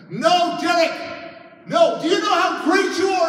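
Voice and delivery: high, very loud desperate yell; screaming plea. Retold or imitated. Counselor screaming at Derek as he advances on her. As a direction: scream it at someone coming at you. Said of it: "she's screaming at me".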